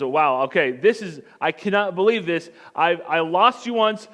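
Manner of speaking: loud, astonished outburst; overwhelmed amazement. Acted. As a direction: blurt it out in acted disbelief and devotion. Mary's amazed joy on recognizing Jesus.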